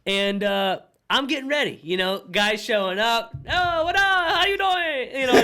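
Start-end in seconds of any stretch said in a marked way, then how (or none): 3.5-5.2 higher, drawn-out hello; mock hearty greeting. Chef greeting guys as they show up, mock hearty. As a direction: play a showy chef's big greeting.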